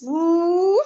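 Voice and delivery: high loud whoop; excited whoop. Real, not acted. Healer celebrating a big release, high loud whoop. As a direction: throw out a high loud woo.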